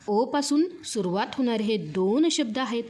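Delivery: normal talking voice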